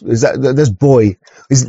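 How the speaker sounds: normal talking voice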